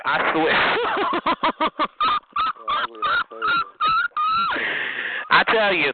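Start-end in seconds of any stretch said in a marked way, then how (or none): 0.6-5.3 long, very high cackle; gleeful mocking laugh. Real, not acted. Streamer baffled by the rival, shrieking cackle. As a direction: cackle high and keep it going.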